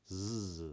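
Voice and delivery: "z" with a buzzing voiced Z. Shown as a demonstration. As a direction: buzz the "z". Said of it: "A Z is voiced".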